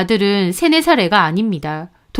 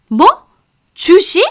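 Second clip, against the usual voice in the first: much higher, louder, stunned; shocked disbelief; 8 semitones higher, 5 dB louder than usual. A narrator's reading. Mother-in-law hearing son sold house for stocks, stunned. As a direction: spit out 주식 in disbelief.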